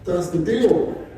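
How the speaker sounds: usual speaking voice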